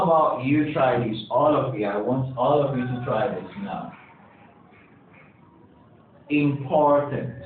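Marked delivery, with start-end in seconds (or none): 6.3-7.3 slow "Important" with a strong POR, im-POR-tant; careful model "Important", im-POR-tant; second-syllable stress. Shown as a demonstration. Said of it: "and the second syllable".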